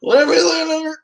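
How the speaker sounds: high, breezy brush-off; mock-casual shrug of a word; dismissive whatever. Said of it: "I'm like".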